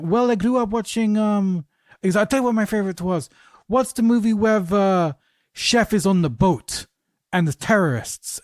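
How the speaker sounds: imitating a French actor in a mock French accent; bad French accent. French actor recalls a favorite film, in a bad French accent. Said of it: "excuse the bad French accent".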